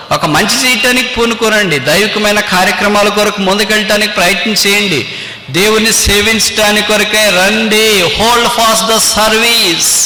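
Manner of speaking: preaching appeal, pressing on phrase after phrase; fervent exhortation. Acted. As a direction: urge the congregation on in one sustained high tone. Preacher exhorts congregation to serve.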